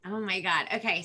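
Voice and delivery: normal talking voice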